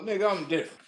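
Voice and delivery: ordinary delivery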